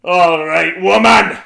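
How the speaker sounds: huge booming bellow at the door; bellowing giant. A narrator's reading. Giant bellowing at Una through the door. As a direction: roar it out like a giant. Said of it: "bellowed the giant at the door".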